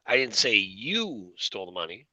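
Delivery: YOU stretched out with a big pitch swell; stress on YOU. Shown as a demonstration. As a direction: lean hard on the word "you". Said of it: "now the emphasis is you".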